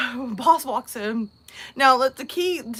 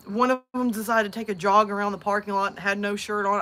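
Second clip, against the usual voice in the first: ordinary talk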